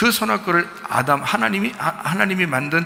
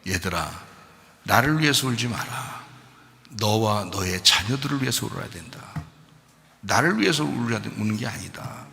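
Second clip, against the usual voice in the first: softer, lower tender address; Jesus tenderly consoling women; gentle Jesus; 4 semitones lower, 5 dB quieter than usual. Retold or imitated. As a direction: speak softly to weeping women. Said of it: "예수님이 뭐라 그래요?".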